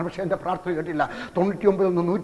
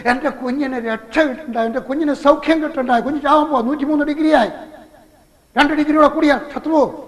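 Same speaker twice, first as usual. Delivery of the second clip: panicked fear; 7 semitones higher, 3 dB louder than usual. Retold or imitated. Father panicking over dying baby.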